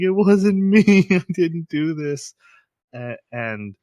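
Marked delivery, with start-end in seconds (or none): 0.0-2.3 high, loud, slow protest; panicked denial. Retold or imitated. Retelling Kaguya insisting her innocence, loud and high. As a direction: cry it out high and loud, defending herself. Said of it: "poor Kaguya is like".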